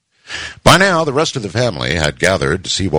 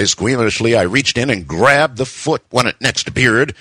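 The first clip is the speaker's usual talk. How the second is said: ordinary delivery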